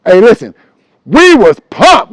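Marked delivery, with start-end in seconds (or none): none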